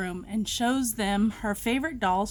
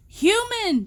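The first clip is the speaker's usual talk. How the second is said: high, louder shriek; shrieking Human; 9 semitones higher, 4 dB louder than usual. A narrator's reading. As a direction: shriek it in fright. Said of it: "shrieks Demi".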